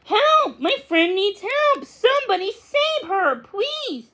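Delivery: high, urgent pleading; urgent kitten. Acted. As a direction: beg for help like a worried kitten. Max begs for help.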